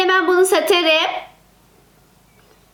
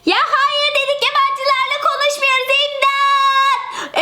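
put-on high, frightened kids crying out for help; high child voice calling for help; 8 semitones higher than usual. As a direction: yell for help like a scared child. Çocuklar yabancıya bağırıyor. (yabancıdan korkan çocuklar, yüksek sesle imdat diye bağırıyor)

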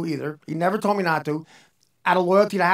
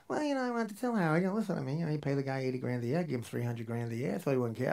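quiet John excuse; 5 semitones lower, 9 dB quieter than usual. Retold or imitated. Imitating John's excuse quietly.

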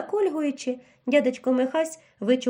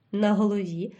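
plain reading voice

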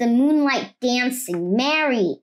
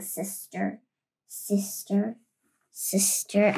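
fading echo, much softer each time; cave echo; 5 semitones lower, 7 dB quieter than usual. Acted. The duck's call echoing back from the cave, fading softer. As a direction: let the word die away like an echo in a cave. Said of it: "A voice from the cave came back".

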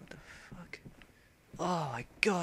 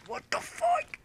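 very high shocked shriek; stunned shriek; shocked squeal; 7 dB louder, slower than usual. Real, not acted. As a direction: shriek in disbelief. Shocked at game.

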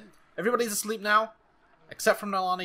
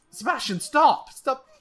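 high, loud shout; frantic command; 9 semitones higher, 7 dB louder than usual. Real, not acted. Gamer yelling at Sebastian to stop, frantic. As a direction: cut in urgently to halt someone. Ordering a game character to stop.

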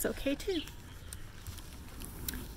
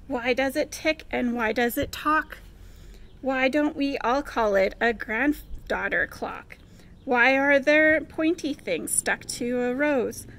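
put-on rhymed questions read out to children; lilting picture-book read-aloud; storybook questions; 7 dB louder than usual. A narrator's reading. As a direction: build each curious question, ending louder.

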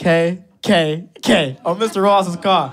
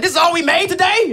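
mock robber; 12 semitones higher than usual. Robber disappointed at the register.

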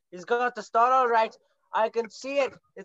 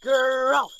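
a loud gruff exclamation; loud outburst; 3 semitones higher, 6 dB louder than usual. Acted. Cinnamon bear exclaims loudly at the dragon. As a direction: bark it out.

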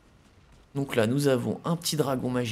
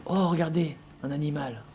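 ordinary delivery

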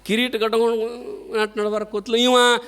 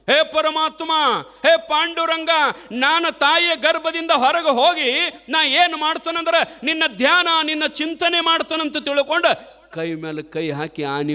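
prayerful plea; 5 semitones higher than usual. Acted. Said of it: "ನಿಜಗುಂಡ್ರು ಭಾಳ ಚಂದ ಬರೀತಾರೆ".

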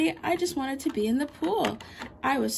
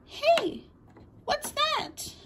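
surprised; 11 semitones higher, much slower than usual. Acted. Spots something at the park.

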